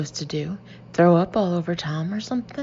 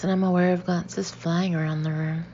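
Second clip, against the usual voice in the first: ordinary delivery, nothing marked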